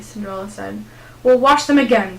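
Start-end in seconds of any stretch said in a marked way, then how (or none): 1.2-2.2 loud, curt command; snapping. Acted. Cruel stepmother orders Cinderella to rewash floors, snapping loudly. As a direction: bite off the order. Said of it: "snapped the cruel woman".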